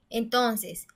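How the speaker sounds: ordinary talk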